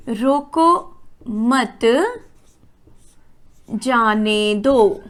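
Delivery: "रोको मत" drawn out slowly, long pause, then "जाने दो"; very slow, pause after मत before जाने दो; comma after मत. Shown as a demonstration. Teacher writing out a sentence for pupils, slow, pause after मत. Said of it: "अब अगर मैं इसमें अल्प विराम लगाऊं यहां पर".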